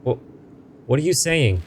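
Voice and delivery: ordinary talk, nothing marked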